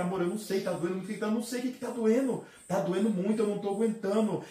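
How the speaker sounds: high, anguished cry for help to his wife; reliving the pain, half yelling; retold crying out. Husband crying out to his wife in pain, high anguished yell. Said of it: "Eu comecei a gritar".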